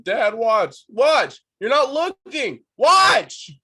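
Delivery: put-on high, loud little kid begging Dad to watch; insistent kid shouts, each one bigger; niño exigiendo atención. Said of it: "that little kid thing".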